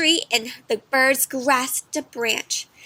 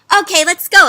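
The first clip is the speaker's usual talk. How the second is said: higher, louder bright okay; eager agreement; 6 semitones higher, 12 dB louder than usual. Acted. Pip the bird eagerly agrees to go. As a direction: jump at the idea. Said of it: "agreed Pip".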